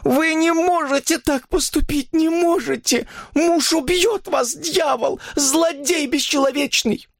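high, slow, ringing desperation; anguished woman pleading for mercy; horrified pleading. A narrator's reading. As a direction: beg and accuse in terror, pitched very high. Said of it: "голос её зазвенел от ужаса".

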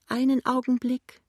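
put-on high-pitched tearful old woman; tearful whine. A narrator's reading. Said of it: "sagt eine weinerliche Stimme". (weinerliche hohe Stimme)

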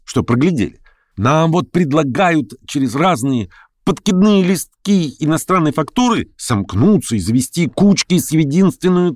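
indignant lame man. Acted. Lame man answers for the provincials, indignant. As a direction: retort insistently with a lifted pitch.

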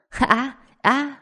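high anguished cry of pain; agonized scream. Acted. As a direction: scream as if your nose was just broken. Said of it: "hét thảm một tiếng".

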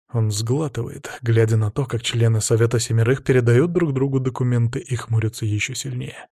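usual speaking voice

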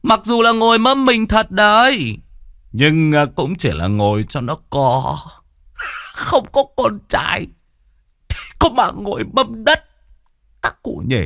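high-pitched sneering gossip; high mocking voice. A narrator's reading.

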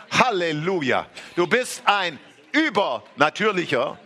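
ordinary talk, nothing marked

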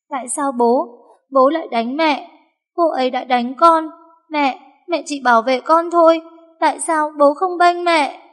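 put-on high, tearful little girl's pleading; sobbing child. A narrator's reading. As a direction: beg her father through sobs. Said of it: "Mây hoảng sợ, ôm chặt lấy mẹ nấc lên từng tiếng". (bé gái nức nở)

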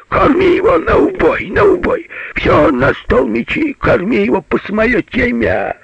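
high, sustained yell; full-voiced shouting. A narrator's reading. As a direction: bawl orders out through the door. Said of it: "в голос закричал". (кричит во весь голос)

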